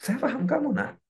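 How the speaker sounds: high-pitched, tender parent voice; high, gentle reassurance to a child. Acted. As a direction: lift the pitch as if talking to your child. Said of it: "bicara sama anak".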